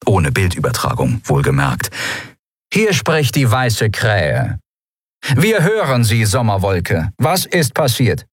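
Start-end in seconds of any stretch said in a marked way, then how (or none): 2.7-8.3 slow, raised radio hail; drawn-out official hail, pitched up; commander on radio. A narrator's reading. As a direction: answer a distress call slowly and deliberately. Commander answers the distress call.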